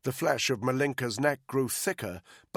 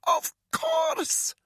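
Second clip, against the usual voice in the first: very high, strained woman's voice; strangled captive; 3 dB louder than usual. Acted. Choking captive confirming, very high and strained. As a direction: gasp out the answer. Malinka confirms under the rope.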